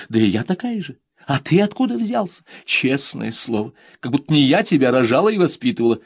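mother lamenting, high and loud; exasperated mother. Acted. Mother to son, half-joking lament. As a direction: complain to the son half in jest.

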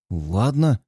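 nervous, swallowing hard; nervous gulp. Acted. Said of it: "нервно сглатывает Тим". (нервно сглатывая)

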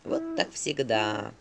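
slow, low resigned sigh. A narrator's reading. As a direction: let it sag with weary disappointment. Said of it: "вздохнул Филин". (со вздохом, медленно и низко)

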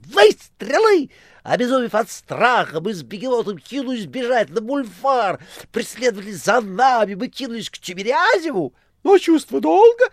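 very high, loud theatrical tale; Korovyev, mock terror. A narrator's reading. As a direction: ham up the panic. Korovyev voiced by narrator. (Коровьев с наигранным ужасом)

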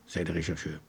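ordinary delivery

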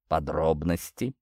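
low, soft, slow demand. A narrator's reading. Prince demanding the captain's details, low and understated. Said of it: "потребовал князь".

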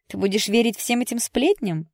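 high, loud indignant question; exasperated. A narrator's reading. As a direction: scold a friend for believing gossip. Friend scolds Jess over gossip.

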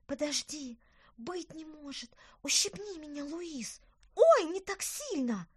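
put-on high, astonished little girl; excited girl. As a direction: gasp out in disbelief. Amazed kids whisper, then yelp.

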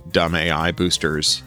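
usual speaking voice

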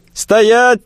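high, loud panicked shriek. Acted. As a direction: shriek the order at him. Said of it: "тут же завизжал толстяк".

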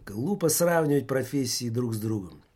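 ordinary delivery

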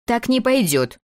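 высоко и резко, недовольно; обрубить фразу как строгий режиссёр; резко отрезав. Acted. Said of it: "отрезала она". (резко, недовольно)

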